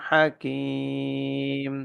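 আলিমুন Hakim drawn out very slowly; chanted tajweed model; slow clear recitation. Shown as a demonstration. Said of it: "তানবিনের পরে হা আসছে।".

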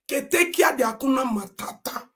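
normal talking voice

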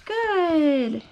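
usual speaking voice

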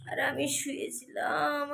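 ordinary talk, nothing marked

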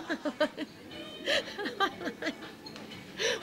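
long squealing giggle; high laugh. Real, not acted. Telling friends about the healer, squealing giggle.

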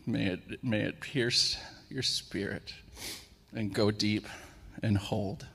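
unhurried prayer with long pauses, names trailing to a whisper; heartfelt prayer. Real, not acted. Preacher worshipping Jesus before the message, hushed reverent prayer.

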